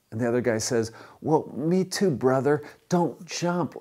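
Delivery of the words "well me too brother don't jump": high, eager delight; acted joy at finding a brother, pitched up; delighted rapport. Rescuer delighted at shared religion.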